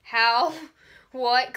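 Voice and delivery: loud baffled outburst with a laugh; blurting out disbelief, laughing; incredulous how. Retold or imitated. Fan reacting to the cousin line, loud and baffled. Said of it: "I was like".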